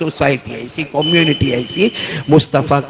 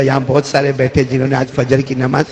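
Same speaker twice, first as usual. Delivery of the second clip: ordinary talk, nothing marked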